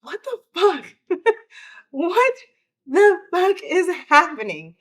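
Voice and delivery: loud, high retold yell with a laugh caught in it; a stunned, half-laughing high yell; yelling in shock. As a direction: shout it out in disbelief at the news. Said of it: "I just remember yelling".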